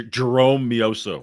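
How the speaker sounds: "Jerome Mioso" in plain American sounds, slow and loud; own pronunciation. Shown as a demonstration. Co-host offering his version of the name, "Jerome Mioso" slow and American. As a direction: give "Jerome" a silent final e, drawn out firmly. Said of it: "I would call him".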